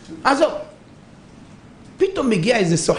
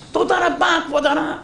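grateful merchant; 9 semitones higher than usual. Acted. Merchant thanks rabbi, relieved.

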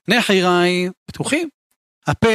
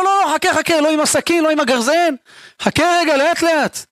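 high, urgent acted plea to wait; panicked pleading; 8 semitones higher than usual. Man begging off the knife and axe, panicked pleading. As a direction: hold them off in a hammed-up panic.

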